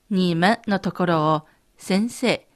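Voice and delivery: ordinary talk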